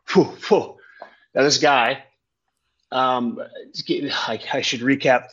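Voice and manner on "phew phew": high mock whooshing of a spin; whirling phew sounds; spinning whoosh. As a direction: puff out the spin noise. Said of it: "I start spinning hard".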